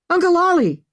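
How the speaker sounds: high, loud cry of the name; panicked yell, voice leaping up; alarmed shout. A narrator's reading. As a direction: shout after someone being lost. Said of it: "Maggie shouted".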